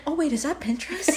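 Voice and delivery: quick, soft, giggly aside; suddenly distracted. Imitating friend noticing the logo, soft sudden distraction. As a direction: drop from panic into a small puzzled question. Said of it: "it was how I said it".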